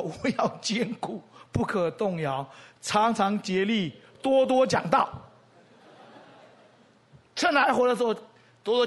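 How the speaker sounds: loud, slow and drawn out, each phrase hammered home; fervent declaration. Retold or imitated. Old pastor vows to keep preaching, slow and loud. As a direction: proclaim it like a vow before the crowd. Pastor recites his resolve aloud.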